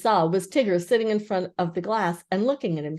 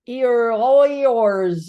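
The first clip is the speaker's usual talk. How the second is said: loud, higher mumble through a mouthful; mouth full; 4 semitones higher, 9 dB louder, much slower than usual. A narrator's reading. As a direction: talk through a mouthful of acorns. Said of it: "his mouth was full of acorns".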